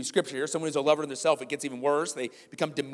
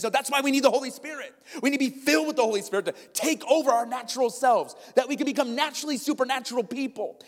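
much higher, louder preaching; full-force preaching; 8 semitones higher, 4 dB louder than usual. Acted. Pastor voicing Paul to the church, full-force preaching. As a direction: press it on them hard.

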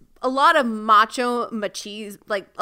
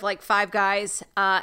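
ordinary delivery

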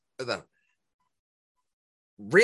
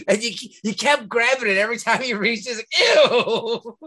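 laughing disgust; 7 semitones higher than usual. Real, not acted. Disgusted about old beer.

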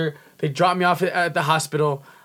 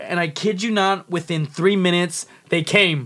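ordinary delivery

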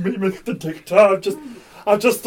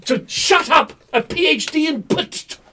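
loud, raised-pitch bluster; indignant outburst; 7 semitones higher, 6 dB louder than usual. Acted. Challenged doctor defends his doctorate, indignant shout. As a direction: shout down the doubters, offended.

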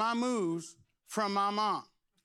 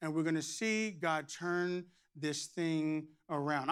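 ordinary talk, nothing marked

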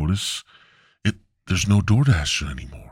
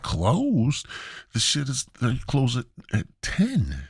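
incredulous; 6 semitones higher than usual. Retold or imitated. Told the store is closed.

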